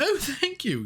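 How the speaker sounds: very high chirpy oh thank you; mock sweet thanks. Imitating the hotel clerk getting the crossword answer, high chirp. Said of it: "She's like".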